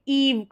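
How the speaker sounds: "ईव" as one syllable ending in v; "eive" as eev. Shown as a demonstration. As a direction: say "ईव" with a long ee. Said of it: "इसको ईव करके पढ़ा जाता है".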